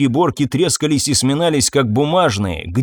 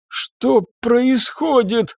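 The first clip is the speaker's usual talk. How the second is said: high, slow wheeze; wheezing; 10 semitones higher, much slower than usual. Acted. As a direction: rasp it out, barely breathing. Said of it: "просипел Гепардис".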